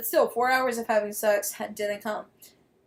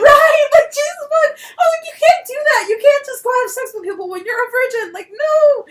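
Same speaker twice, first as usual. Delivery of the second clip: much higher, much louder laughing outrage; laughing shout; 13 semitones higher, 13 dB louder than usual. Real, not acted. Telling friends about the virgin, laughing outraged shout. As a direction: shriek it through laughter.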